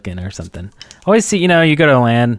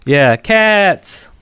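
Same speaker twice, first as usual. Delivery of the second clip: mock shout; 8 semitones higher than usual. Joke shouting plural words.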